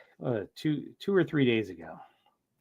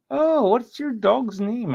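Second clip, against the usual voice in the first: put-on high, slow, singsong old lady; retold older lady, pitched way up; 9 semitones higher, slower than usual. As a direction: imitate a sweet-talking older lady, drawn out. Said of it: "some old, like, older lady".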